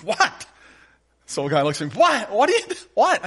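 high, loud incredulous "what?"; shocked old pastor. Retold or imitated.

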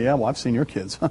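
low, soft, dry comeback; offhand mock-dismissive retort; eye-rolling dismissal. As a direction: roll your eyes as you say it. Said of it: "roll your eyes and say".

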